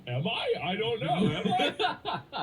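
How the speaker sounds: high, laughing self-doubt; mock panic. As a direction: squeak it out in hammed-up alarm. Retelling own alarmed reply, laughing.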